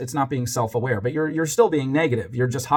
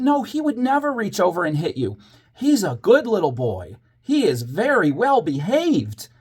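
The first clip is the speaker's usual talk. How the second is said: high, exaggerated teacherly praise, slowing down; play-acting teacher vouching for a pupil; put-on praise; 8 semitones higher than usual. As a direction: lay on the approval for a child, drawn out. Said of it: "I kept on with the act".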